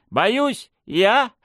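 very high, incredulous questioning; ironic. A narrator's reading. Lady answers the charge of cowardice, ironic. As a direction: echo the accusation with mock disbelief. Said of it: "иронично переспросила Дмитриева".

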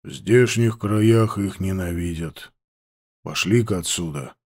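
low, quiet, grim. Acted.

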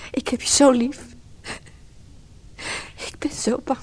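high, aching declaration fading to a whisper; love turning to fear; tearful. Acted. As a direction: plead with him to live. Praying for injured lover.

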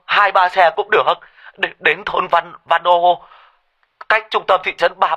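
high, loud, stammering panic; yelling into phone. Acted. Panicked husband yelling to the fire brigade on the phone. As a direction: shout down the line in a flap. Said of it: "anh Kỳ đã gào lên vào trong cái điện thoại".

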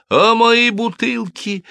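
very high, drawn-out wail; wailing shopkeeper. A narrator's reading. Shopkeeper fearing for her bottles, wailing. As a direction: wail it slowly in a high put-on voice. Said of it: "завопила гражданка Плюмо".

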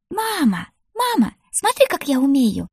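put-on very high little-girl voice, excited; happily squealing child. A narrator's reading. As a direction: squeal for mum's attention. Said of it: "счастливо визжащую дочь". (счастливый детский визг)

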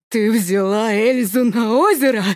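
alarmed father. Acted. (низко, с ужасом)

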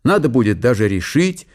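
ordinary delivery

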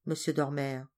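plain reading voice

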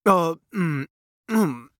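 hesitant mumbling prince voice; stammering prince. A narrator's reading. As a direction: stammer it out, unsure. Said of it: "промычал принц Андерс, заикаясь".

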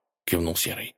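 ordinary delivery

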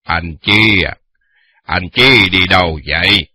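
a hint of a commanding old lord; stately question. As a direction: ask with calm authority. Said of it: "dõng dạc hỏi".

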